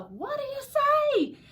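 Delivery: loud, very high slow cheer; drawn-out rousing holler; crowd joining in. A narrator's reading. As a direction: shout it as an eager crowd, stretched out. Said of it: "the crowd all joined in".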